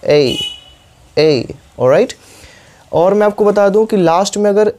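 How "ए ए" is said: ए as ei with a light i at the end; model A. Shown as a demonstration. Teacher repeats ए for students to copy. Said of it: "हल्का सा sound करना है".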